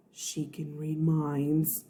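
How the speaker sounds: soft slow reveal; hushed dramatic. A narrator's reading. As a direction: drop it to a hush. Dramatic realization.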